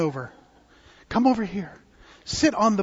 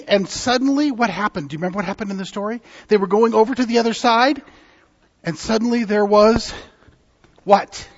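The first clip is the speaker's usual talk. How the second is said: ordinary talk, nothing marked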